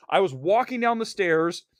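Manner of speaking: ordinary delivery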